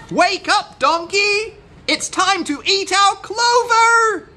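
very high, loud wake-up call; shouting. A narrator's reading. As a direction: call out excitedly to rouse a sleeper. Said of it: "they shouted".

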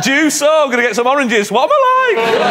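high, loud, hammed-up ditzy voice; silly umpire voice. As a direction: play a scatterbrained umpire, rising to a shout.

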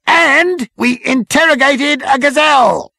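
high, loud, slow proclamation; zealous military subordinate. Acted. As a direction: announce it like a great victory. Number Two's proud final report.

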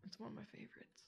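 soft unvoiced whisper. Real, not acted. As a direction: whisper it to yourself. Whispered aside.

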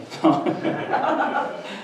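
brief chuckle at own joke; short laugh. Real, not acted. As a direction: laugh lightly after the aside. Joking aside about own kids.

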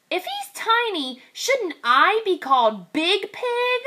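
high indignant piglet; high piglet protesting; little pig. Acted. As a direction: complain like a small kid.

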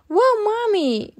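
high, loud amazed wow; amazed gasp. Acted. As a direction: gasp in wonder. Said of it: "gasped Peppa".

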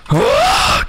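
piercing startled scream; a real shriek of alarm; sudden high scream. Real, not acted. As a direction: let out a sharp scream. Sudden yell in play.

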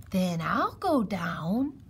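slow, sinuous offer; drawn-out creature voice; snake voice. A narrator's reading. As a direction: stretch the words like a snake.

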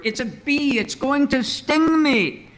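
put-on high, panicked little girl's squeal; squealing in horror. A narrator's reading. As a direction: squeal it like a child who sees the bee. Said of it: "she squealed in horror".

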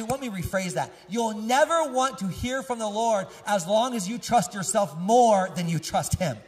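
usual speaking voice

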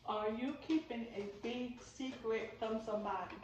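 slow, deliberate question; measured re-asking so nothing is rushed; slowed-down repeat. Real, not acted. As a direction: ask it slowly, word by word. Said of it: "You said it too fast".

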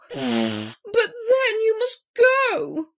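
slow, high, tearful plea; sniffling. A narrator's reading. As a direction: give in through mock sniffles. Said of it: "he sniffled".

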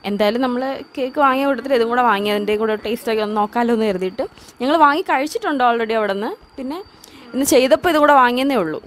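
small bites between words; talking while nibbling. Real, not acted. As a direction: hand at mouth chewing. Seen 5: Woman likely speaking, repeatedly bites small snack held at mouth.

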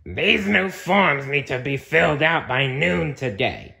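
strict monster manager voice; bossy monster. Acted. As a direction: announce it like a stern new boss. Floz character voice.

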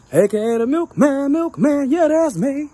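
high, loud, drawn-out nickname call; hyped intro catchphrase. Pretend. Host announcing his nickname to viewers, hyped high call.

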